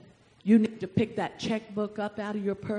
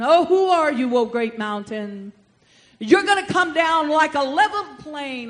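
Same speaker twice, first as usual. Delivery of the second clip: loud, raised preacher's taunt; bold authoritative challenge; 4 dB louder than usual. Acted. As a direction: call out the mountain with authority. Prophet challenges the mountain with authority.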